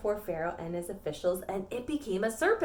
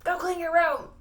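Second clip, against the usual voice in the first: high, loud mock order; stern parent; 8 semitones higher, 6 dB louder than usual. Parent telling the child to clean up, mock shout. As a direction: order it like a fed-up parent, pitched up. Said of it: "And then your parents say".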